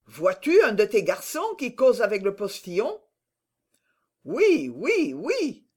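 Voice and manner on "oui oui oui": high, swooping run of replies; excited, singsong high voice; flustered yeses. A narrator's reading. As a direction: chant agreement excitedly, pitch up and down. Servant answers excited master's questions.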